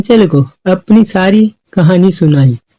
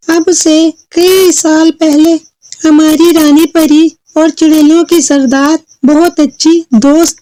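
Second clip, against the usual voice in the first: high, measured fairy voice; storytelling fairy; 8 semitones higher than usual. Acted. Fairy tells girl of queen and witches, high storytelling voice. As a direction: recount old history in a raised voice.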